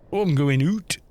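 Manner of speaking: far-northern British accent, "out" said as "oot"; put-on Scottish-Geordie voice; Scottish and Newcastle accent. Shown as a demonstration. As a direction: do Scots or Geordie with a long OO. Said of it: "like, the far north, so Scotland".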